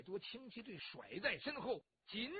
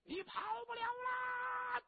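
much higher, slow stretched yell; drawn-out shout; 14 semitones higher, slower than usual. Acted. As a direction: bellow it long after the runaway. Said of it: "魏文通在后边还在喊".

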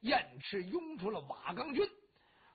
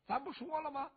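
ordinary delivery, nothing marked

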